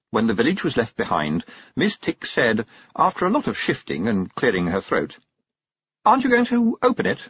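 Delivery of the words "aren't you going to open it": high, quick, eager question; bursting with curiosity. A narrator's reading. Witch who hates not knowing, bursting to ask. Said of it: "bursting to ask a question".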